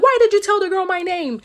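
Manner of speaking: high, loud acted screech; furious confrontation. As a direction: yell it as the livid old lady. Livid lady confronts the crab.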